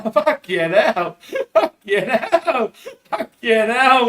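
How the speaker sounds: can't get it out for laughing. Real, not acted. Alone on camera, cracking himself up while laughing. Laughing at his own line.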